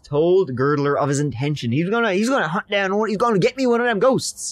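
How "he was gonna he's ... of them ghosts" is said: high, fast, excited rush; eager Francis. Retold or imitated. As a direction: blurt it out like a keen hunter. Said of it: "that's what he was like".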